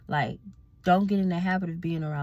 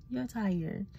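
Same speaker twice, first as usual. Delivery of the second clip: normal talking voice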